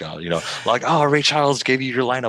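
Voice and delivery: high, loud mocking roast. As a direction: clown on his haircut, loud and high. Roasting a kid's haircut.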